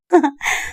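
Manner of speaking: short high character laugh; a brief amused laugh; light laugh. Acted. Woman laughs at his shock.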